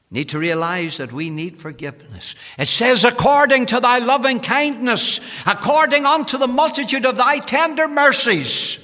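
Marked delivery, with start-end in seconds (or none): 2.6-8.8 loud, high scripture proclamation; full-force preaching. Real, not acted. Preacher proclaims David's psalm to congregation, full-force preaching. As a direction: cry the verse out from the pulpit.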